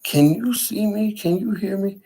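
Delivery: high-pitched, wondering questions; incredulous questioning. Retold or imitated. Acted questioning of figures in mirror.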